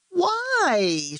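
put-on boy whines at his father's request; whining. A narrator's reading. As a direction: complain like a sulky kid. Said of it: "Sean whined".

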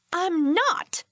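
high-pitched angry denial; shouted. A narrator's reading. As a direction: deny it crossly in a contained shout. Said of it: "shouted at both of them".

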